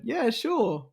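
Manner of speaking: high, innocent agreement; naive younger self. Retold or imitated. Winner mimicking his reply to a late-night invitation, naively agreeable. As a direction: imitate your naive self saying yes. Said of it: "very naive [name] just went".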